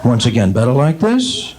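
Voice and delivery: low question rising slowly, then the alternative falling; eye doctor. Acted. Eye doctor testing a patient's lenses, deep deliberate impression. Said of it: "my impression of every eye doctor in the world".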